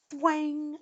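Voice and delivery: high, ringing twang; twanging arrow noise. A narrator's reading. Reader voices third arrow shot, high twang. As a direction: imitate a bow firing. Imitated arrow sound in the story.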